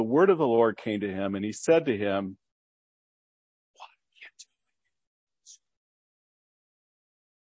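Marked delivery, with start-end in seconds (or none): 3.7-7.5 breathy hushed whisper; soft whispered question. Shown as a demonstration. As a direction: whisper it like a voice in the night. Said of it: "I think it was just like that".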